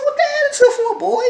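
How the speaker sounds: high, loud mock scolding; sassy challenge. Scolding the catfisher over his rude attitude, high and sassy. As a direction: call him out with attitude. Narrator confronts rude caller.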